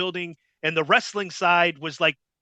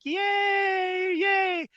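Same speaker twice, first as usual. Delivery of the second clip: crowd cheering; 14 semitones higher than usual. Retold or imitated. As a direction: cheer like a whole arena, pitched way up. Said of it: "the crowd's like".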